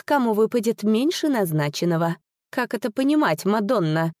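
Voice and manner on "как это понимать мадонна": ordinary delivery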